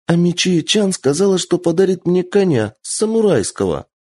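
put-on high, eager child voice; bragging like a small girl; excited little girl. Child boasts to old servant.

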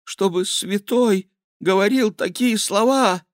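very high-pitched horrified reproach; appalled king's thin high voice; scandalised. A narrator's reading. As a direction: squeal it in pious shock. King scandalised by monk.

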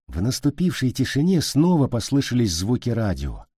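plain reading voice

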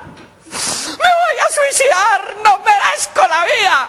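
high, slow mock wail; hammed-up despair. Jilted, fired man giving up, wailing comically. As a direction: wail dramatically, stretching the words. Unlucky man announces suicide.

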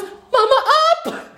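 put-on high, loud little-girl plea to be lifted; scared toddler. Mother voicing her scared daughter by a skeleton, high toddler plea. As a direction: squeak it out like a frightened toddler reaching up. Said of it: "And she goes".

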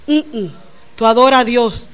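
usual speaking voice

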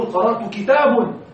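كتاب pronounced "kitabun" as a wrong example; wrong nominative ending. Shown as a demonstration. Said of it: "لا تقل".

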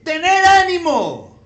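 slow, high, loud call; proclaiming encouragement. Acted. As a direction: call it out to frightened men. Jesus's words proclaimed to disciples.

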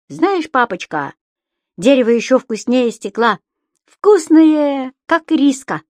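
high excited creature voice; Субастик. A narrator's reading. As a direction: gush about the tasty wood. (высокий восторженный голосок)

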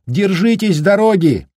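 высокий прощальный выкрик; крикнуть напутствие вслед; крик на прощание. Acted. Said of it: "крикнул на прощание Даркин". (прощальный выкрик вслед)

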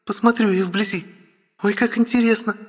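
put-on high, eager young girl; thrilled girlish chatter; excited girl.

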